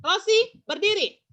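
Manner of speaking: "Rosi berdiri" as a loud order, pitched high then falling; command intonation. Shown as a demonstration. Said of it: "Mem lagi sebutkan kalimat perintah". ("Rosi berdiri" dengan nada perintah yang lantang)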